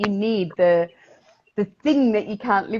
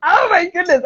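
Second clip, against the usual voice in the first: excited exclaim; 11 semitones higher, 9 dB louder than usual. Real, not acted.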